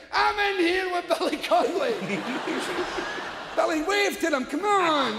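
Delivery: excited wife calling out. Retold or imitated. Wife calling out limo window.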